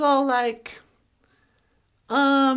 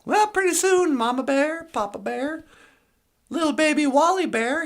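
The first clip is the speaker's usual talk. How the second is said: usual speaking voice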